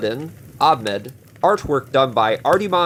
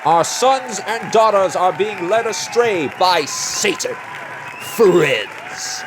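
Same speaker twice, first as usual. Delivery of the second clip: rabble-rousing small-town mayor; 5 semitones higher than usual. Acted. Small-town mayor rallying his voters against comics, fiery stump speech. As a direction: whip up the crowd like a fearmongering politician.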